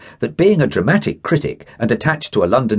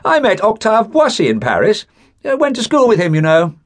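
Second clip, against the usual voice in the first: high, louder, casual bragging; imagined boasting; 13 semitones higher, 3 dB louder than usual. Pretend. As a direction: show off to the office, name-dropping. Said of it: "I could hear myself saying".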